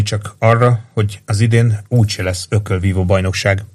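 plain reading voice

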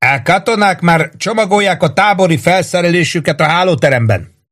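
sustained high-pitched call; narrated shout, pitch held up. A narrator's reading. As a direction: yell a report down from above. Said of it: "ordította le Warden".

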